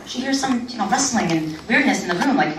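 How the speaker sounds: ordinary talk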